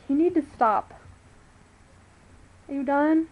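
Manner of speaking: ordinary delivery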